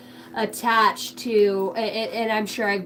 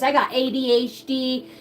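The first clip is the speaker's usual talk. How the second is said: normal talking voice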